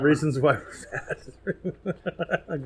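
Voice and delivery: laughing. Real, not acted. Repeats episode title, laughing.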